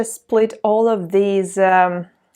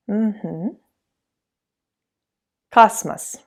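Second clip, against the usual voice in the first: ordinary delivery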